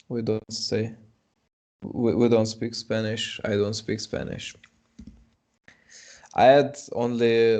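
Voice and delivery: ordinary delivery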